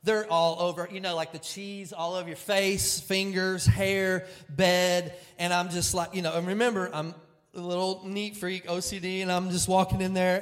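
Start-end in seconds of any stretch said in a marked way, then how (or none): none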